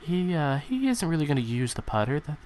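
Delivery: hushed, hesitant golf commentary; timid golf announcer. Acted. Commentator following Tiger Woods up to the green, timid hush. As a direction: call the putt gently, full of little uhs. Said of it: "I'm a very timid person announcing golf".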